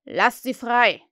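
loud commanding captain's bark; fake Hook order. Peter as Hook orders the pirates, loud command. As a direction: give the order as the captain would. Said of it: "ahmte er die Stimme von Hook nach".

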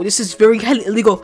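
normal talking voice

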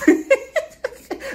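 high burst of laughter; real laugh. Real, not acted. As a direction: crack up, loud and high-pitched. Cracks up at the quoted line.

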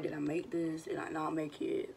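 whispered, low, anxious; nervous whisper. Retold or imitated. Nervous singer whispers to herself awaiting the verdict. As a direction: whisper it to yourself, scared. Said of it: "my heart was racing".

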